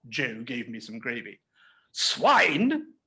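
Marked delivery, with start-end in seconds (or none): none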